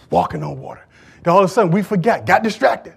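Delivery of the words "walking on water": much lower, softer repeat; hushed low echo of the shout; quiet echo. Shown as a demonstration. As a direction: drop it to a low murmur. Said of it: "We were walking on water".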